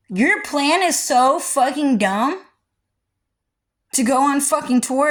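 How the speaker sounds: telling off a friend while close to crying; 1 tearful. Real, not acted. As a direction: voice pushed high as if near tears. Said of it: "so I wasn't crying".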